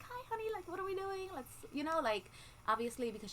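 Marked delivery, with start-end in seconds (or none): 0.0-1.6 much higher, sing-song mom voice; extra chipper mom. Retold or imitated. Mom talking to her five-year-old daughter, extra chipper and higher. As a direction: put on the cheerful voice for a small child. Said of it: "extra chipper when I'm with her".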